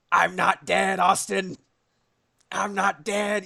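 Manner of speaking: hammed-up boss crowing that he survived; Sigma. Streamer mimics the game boss's teaser, mock villain taunt. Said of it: "Sigma being like".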